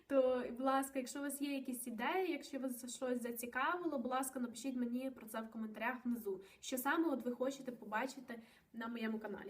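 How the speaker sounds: ordinary talk, nothing marked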